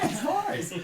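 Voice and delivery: faking eagerness to take the photo; mock polite. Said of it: "And I'd be like".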